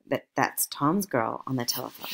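ordinary delivery

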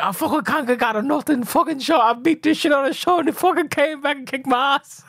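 high-pitched mock griping; imitated rant. Retelling a beaten rival's complaint, high mock rant. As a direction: mimic his sore-loser rant up high.

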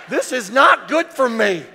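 loud laughing protest; laughing shout. Retold or imitated. Preacher as a boy shouting about the peas. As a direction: yell it out through a laugh. Kid choking down creamed peas.

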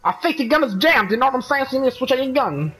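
light Brooklyn joker voice; lightly held Brooklyn accent. Acted. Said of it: "now he has a Brooklyn accent".

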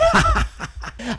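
high villain cackle; high-pitched gloating laugh; evil cackle. Pretend. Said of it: "villain laugh".

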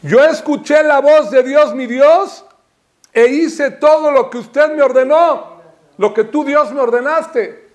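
loud, high declaimed verse; ringing scriptural declaration; impassioned preaching. Acted. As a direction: proclaim the verse to the congregation.

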